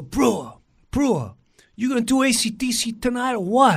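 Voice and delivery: high-pitched Long Island guy; pushy guitarist buddy voice; Tommy Burns. Retold or imitated. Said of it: "Long Island guy".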